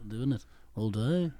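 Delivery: slow Liverpool lilt. Retold or imitated. Still copying the musicians, slow Liverpool accent. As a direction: stretch it out in imitated Liverpool accent. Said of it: "talking like this".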